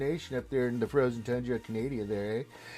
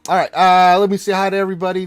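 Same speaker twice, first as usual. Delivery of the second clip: big, loud welcome to the chat; loud greeting; 5 semitones higher, 16 dB louder than usual. Real, not acted. Streamer greeting his chat, loud and excited. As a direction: throw the hello out to everyone, louder.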